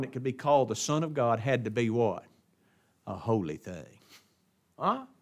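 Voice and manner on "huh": normal talking voice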